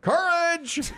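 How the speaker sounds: shouting the answer again at the quizmaster. Retold or imitated. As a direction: yell it again, far bigger this time. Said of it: "So I went".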